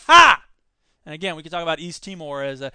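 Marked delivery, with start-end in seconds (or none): 0.1-0.4 loud high mocking ha; one loud derisive laugh; scornful laugh. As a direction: bark out a scornful ha. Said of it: "I say".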